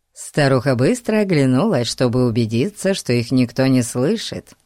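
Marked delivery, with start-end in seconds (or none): none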